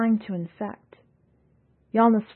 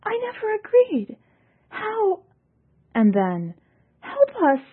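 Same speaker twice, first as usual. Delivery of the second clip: much higher, panicked; desperate cry; 4 dB louder than usual. A narrator's reading. Ekarit begging for help, high and panicked. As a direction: plead for help in terror. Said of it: "Ekarit cried".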